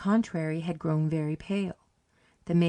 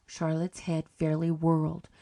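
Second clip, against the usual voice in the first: ordinary delivery, nothing marked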